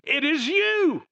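high, loud, slow and beaming; overjoyed cry of recognition; joyful exclamation. Acted. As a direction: burst out with joy at seeing them. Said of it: "He says".

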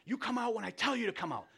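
acted angry parent, high-pitched and fired up; mock-angry scolding, pitched high. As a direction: lay down the law as a mad parent. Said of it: "if you discipline out of anger".

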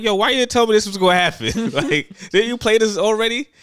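high-pitched retold yell; yelling at teammate. Player blaming teammate for not warning him, high mock yelling. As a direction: reenact an alarmed, accusing holler. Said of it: "I'm yelling at Jazz".

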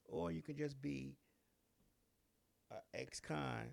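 normal talking voice

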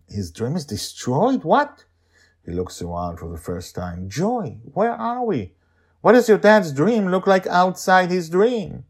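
sudden shock on What; confused boy. Acted. Charlie confused asking Joy where they are.

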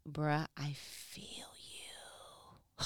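slow breathy whisper to the text. Retold or imitated. Preacher whispering to Matthew, a slow hushed whisper. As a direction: confide it under your breath. Speaker talks to scripture.

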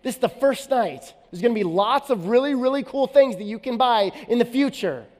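stern dad lecture. Retold or imitated. Father continues lecturing daughter.

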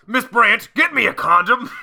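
high, loud barking newspaper boss; J. Jonah Jameson voice. Retold or imitated. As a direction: bark orders like a gruff editor. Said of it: "The J. Jon".

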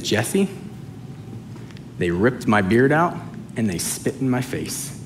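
low, soft voice of the Lord, pausing between phrases; hushed, understated reproach; quiet rebuke. Acted. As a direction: say it softly and gravely, letting it sink in. The Lord gently rebukes the preacher.

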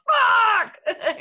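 high, loud shout of dismay; anguished yell. Acted. Someone learning their bad luck at conviction, mock-anguished shout. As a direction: yell it out in comic despair. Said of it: "where they're like".

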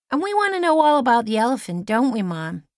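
put-on high, loud and fast, a boy's eager plea; an excited kid hurrying to keep the story going; young boy Carl. A narrator's reading. Boy begging Lizzie to tell the elephant story, fast and loud.

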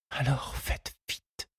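hushed, slow, half-asleep whisper; soft whispered urgency; breathed whisper. A narrator's reading. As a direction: breathe it out softly, drowsy. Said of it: "souffla Henriette".

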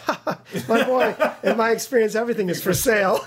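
hearty tycoon's laugh, high and jovial; Richard Branson. Retold or imitated. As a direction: imitate a grand businessman, chuckling through the words. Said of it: "And he goes".

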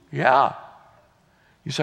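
ordinary talk, nothing marked

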